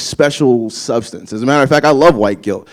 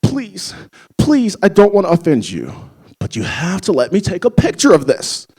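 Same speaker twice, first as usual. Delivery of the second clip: flustered mom; 6 semitones higher than usual. Retold or imitated. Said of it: "She's just like".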